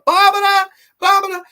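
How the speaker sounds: very high, loud mock cry; over-the-top high-pitched holler; calling out a name. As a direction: squawk it out in a silly high shout. Calls out character's name mockingly.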